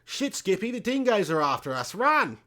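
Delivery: hammed-up Australian warning cry; Australian accent. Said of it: "It's not my best accent".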